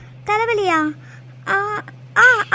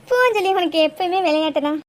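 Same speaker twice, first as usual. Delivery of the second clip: ordinary talk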